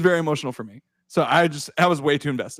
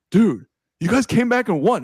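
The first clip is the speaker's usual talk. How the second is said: brother's excitement; 8 semitones higher, 5 dB louder than usual. Retold or imitated. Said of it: "He's like".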